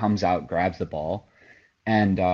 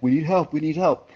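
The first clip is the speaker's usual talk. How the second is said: higher, urgent retold call for help; 8 semitones higher than usual. Ref calling the coach over, urgent and higher.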